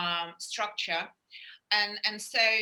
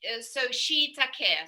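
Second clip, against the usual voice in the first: normal talking voice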